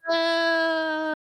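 long, high held wail; mock scream. A narrator's reading. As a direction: hold a melodramatic high "no". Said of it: "he screamed".

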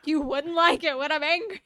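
a hint of a hammed-up threat; mock Hulk warning. Finishing the famous line to her cohost as a mild mock threat. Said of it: "Don't make me angry".

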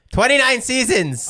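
loud, high-pitched disbelief; high, loud exclamation of shock; astonished outburst. Real, not acted. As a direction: blurt it out in amazement. Amazed at the season count.

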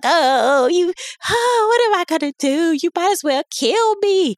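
put-on high, sobbing seventies jive accent; Missus Pimp. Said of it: "do it in the nineteen seventies jive accent".

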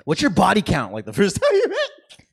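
loud, high and laughing; high-pitched outburst breaking into laughter; mock incredulous question. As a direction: blurt it out in loud disbelief. Mocking an intrusive first-meeting question.

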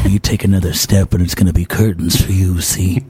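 1930s gangster. Acted. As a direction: threaten like an old movie mobster. Gangster threatens the fireman.

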